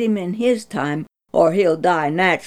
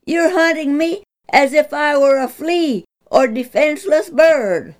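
earnest plea; 8 semitones higher, 4 dB louder than usual. Acted. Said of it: "David's earnest plea".

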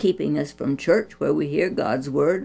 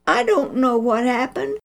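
near tears; 6 semitones higher than usual. A narrator's reading. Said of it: "Penny blinked back the tears".

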